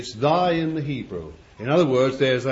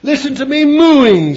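very high, loud mock bull boasting; boastful bull; 10 dB louder than usual. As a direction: show off like a bellowing bull. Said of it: "says the male cow".

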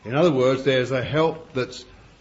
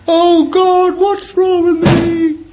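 very high, loud, slow mock wail; self-pitying wail; 9 dB louder, slower than usual. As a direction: moan it like a weakling sorry for himself. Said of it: "some who are naturally weak".

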